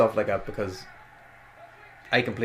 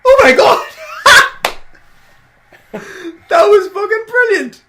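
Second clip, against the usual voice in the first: high, loud thrilled yelling; excited shout; 15 dB louder than usual. Real, not acted. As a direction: scream with real delight. Reacting live to the moonsault.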